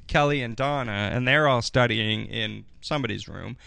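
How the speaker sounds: usual speaking voice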